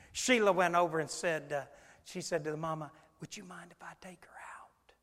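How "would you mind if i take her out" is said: barely voiced whispered request; discreet whisper. Sheila asks the mama to take the child, discreet whisper. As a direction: whisper it so the congregation can't hear. Discreetly asking mother during church service.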